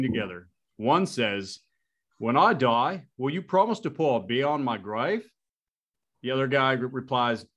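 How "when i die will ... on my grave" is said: a raised, hammed-up Australian accent on "When I die"; an American putting on a so-so Australian accent; attempted Australian accent. American telling an Aussie joke to Mike, in a hammy Australian accent. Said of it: "that was the best Australian I got there".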